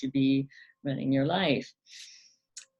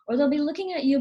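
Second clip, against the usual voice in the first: ordinary talk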